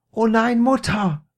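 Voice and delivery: high, loud exasperated cry; calling out. A narrator's reading. Peter on stretcher to his mother, high loud cry. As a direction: shout back to mother in protest. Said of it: "rief Peter".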